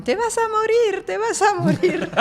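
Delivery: high-pitched mock shriek of warning; imitated screaming. Passer-by yelling at her for jaywalking, imitated screaming. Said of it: "una señora me empezó a gritar en alemán".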